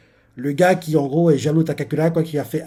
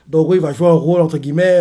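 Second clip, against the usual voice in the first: usual speaking voice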